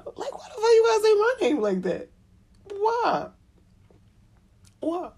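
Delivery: high, loud exasperated cry; exasperated yell. Real, not acted. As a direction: fling it out in comic despair. Exasperated at baby.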